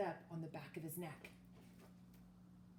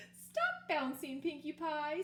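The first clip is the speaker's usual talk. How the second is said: higher, slow motherly telling-off; scolding; 6 semitones higher, 3 dB louder, slower than usual. Acted. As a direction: stop the child from bouncing. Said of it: "scolded Mama".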